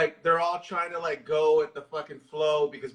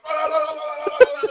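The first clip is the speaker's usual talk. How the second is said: high, louder panicked yelps; mock panicked cries; 10 semitones higher, 7 dB louder than usual. Retelling the boy yelping over the dying dog, high panic. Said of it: "And he's like".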